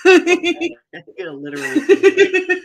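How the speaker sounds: high, loud, squealing through laughter; can barely get words out for laughing; laughing hard. Real, not acted. Cohost cracking up at the silly intro, laughing.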